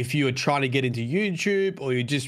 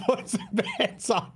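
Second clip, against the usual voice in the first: laughing through an Aussie bettor line; Australian accent; 8 semitones higher than usual. Retold or imitated. Echoing friend's joke, laughing Australian accent. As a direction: giggle out the Aussie punter line. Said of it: "very much more Australian".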